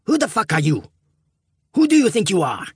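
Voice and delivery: loud, high angry shout. A narrator's reading. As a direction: yell it at the stranger. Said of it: "Barco shouted at him".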